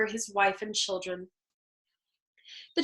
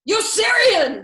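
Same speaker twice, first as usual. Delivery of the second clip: loud, high shout of the name to hold him back; 10 semitones higher, 9 dB louder, slower than usual. Acted. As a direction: call out Yossarian sharply to restrain a friend. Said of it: "Nately shouting".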